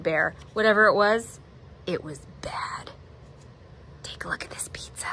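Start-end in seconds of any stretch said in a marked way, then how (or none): 1.9-5.1 whispered, ominous; a secret, breathy whisper; hushed whisper. A narrator's reading. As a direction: lean in and whisper it. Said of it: "ominous aside".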